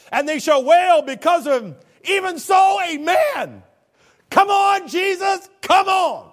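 loud, high and rising call; shouting amen with full fervor; fervent preaching shout. Real, not acted. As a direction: cry it out to the rafters. Preacher shouting scripture and plea.

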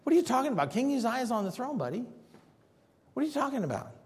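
high, incredulous scoff; scoffing contemporaries. Acted. Amos's contemporaries scoffing at his promise, high mock disbelief. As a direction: brush the prophet off, mockingly. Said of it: "Amos's contemporaries were saying".

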